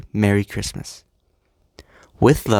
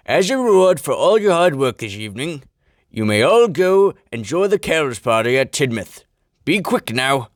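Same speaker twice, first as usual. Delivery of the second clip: higher put-on chief's voice, pleased; warm Fat Controller; 5 semitones higher than usual. A narrator's reading. Fat Controller rewarding the engines, warmly. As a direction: hand out a reward in character. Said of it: "greeted them warmly".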